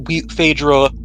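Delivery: ordinary talk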